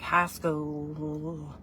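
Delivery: drawn-out country drawl on the vowels; country accent. Shown as a demonstration. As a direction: stretch it out slowly in country style. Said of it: "that was actually my accent".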